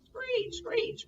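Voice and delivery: very high screech fading to breath; bird screech. Acted. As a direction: screech like a pheasant. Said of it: "cried the pheasant".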